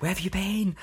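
high-pitched retold demand; mock angry mum. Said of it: "she was so angry".

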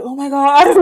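teacher gasping out "Oh my God"; teacher's alarm. Retold or imitated. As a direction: react like a startled teacher. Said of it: "The teacher's like".

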